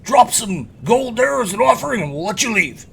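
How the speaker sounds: high, loud bandit demanding a toll; roadside robber. Acted. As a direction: pitch it up and project the robber's demand. Game master voices robber demanding gold.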